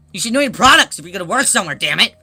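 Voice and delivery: fast, loud mock tirade; worked-up, rapid-fire outburst; pissed off. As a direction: scold the clerk in an exaggerated rant. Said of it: "I was getting really pissed".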